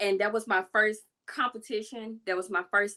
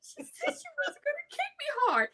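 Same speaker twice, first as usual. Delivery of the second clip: high, whining excuse then retort; high kid excuse; 10 semitones higher, 5 dB louder than usual. Retold or imitated. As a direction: squeak out the excuse.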